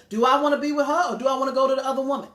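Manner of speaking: rushed, voicing his torn thoughts; quick mock inner voice. Pastor voicing her husband's inner doubt, quick and mocking.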